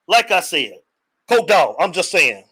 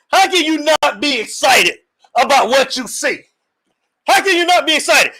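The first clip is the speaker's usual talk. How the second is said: excited; 6 semitones higher than usual. Real, not acted. Host hyping fans.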